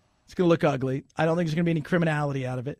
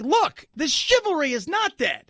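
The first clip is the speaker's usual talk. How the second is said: mock gallant declaration; 8 semitones higher than usual. Voicing the stand-up guys.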